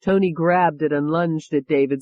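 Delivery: usual speaking voice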